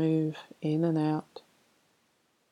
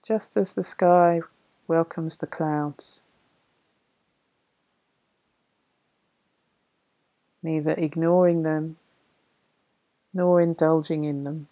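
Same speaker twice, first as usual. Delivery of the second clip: poem reading. Acted. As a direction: read the verse gently and unhurried. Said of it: "I'll read you a poem".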